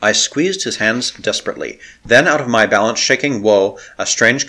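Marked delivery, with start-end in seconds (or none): none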